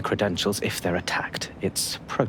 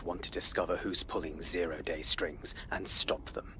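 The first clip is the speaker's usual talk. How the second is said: low conspiratorial whisper; 3 semitones lower, 9 dB quieter than usual. Acted. Agent agreeing on a shared goal, whispering. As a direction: keep it hushed between the two of you. Whispering secret plans to ally.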